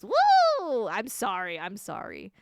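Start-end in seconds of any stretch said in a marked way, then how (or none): 0.0-0.9 high, loud whoop; drawn-out silly whoop; brain going woo. Shown as a demonstration. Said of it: "sometimes my brain goes".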